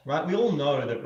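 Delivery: ordinary delivery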